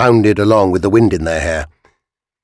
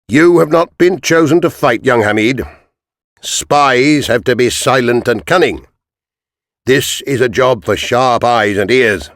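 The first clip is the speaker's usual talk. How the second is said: lightly raised, measured captain's voice; a commander setting out the job, slowing down; a hint of a stern army captain; 5 semitones higher than usual. Captain briefing the boys.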